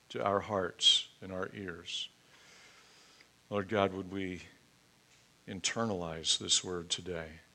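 low, soft prayer voice; hushed, reverent prayer, falling away at the amen. Real, not acted. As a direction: pray quietly and unhurriedly, pausing between phrases. Leads a congregational prayer.

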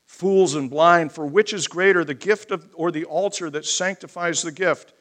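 loud, emphatic proclamation of scripture; forceful scripture preaching. Acted. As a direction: hammer the opening words of each rebuke.

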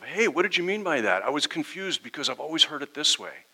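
a hint of a genuinely confused churchgoer; calm honest question. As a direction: ask it openly, wanting to understand. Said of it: "if you come with that attitude of".

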